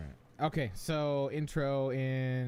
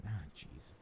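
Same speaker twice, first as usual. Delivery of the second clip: ordinary talk, nothing marked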